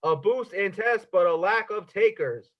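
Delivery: slow, weighted headline read; reading in all caps. Shown as a demonstration. Mocking the newspaper's all caps headline, slow and weighted. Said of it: "all caps".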